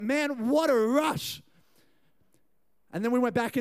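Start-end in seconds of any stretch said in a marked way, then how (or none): 0.0-1.4 slow, drawn-out awe; retold exhilaration. Friends outside after the food, drawn-out retold exhilaration. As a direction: stretch each word out, savouring the thrill.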